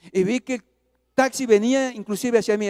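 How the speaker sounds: usual speaking voice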